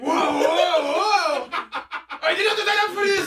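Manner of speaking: loud, high-pitched shriek of protest; hammed-up freak-out as the shocked driver; mock panic. Acting out the shocked driver's reaction.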